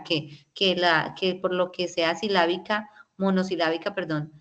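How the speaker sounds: ordinary talk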